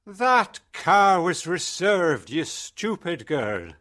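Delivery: angry shout. A narrator's reading. Boss shouting angrily at his assistant. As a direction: shout it high, stretching the words. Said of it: "he shouted".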